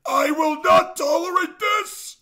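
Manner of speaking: high, loud, slow mock outburst; mock furious shout. YouTuber hamming up the fan's caps-lock threat as a high shout. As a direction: yell it like a petty tantrum.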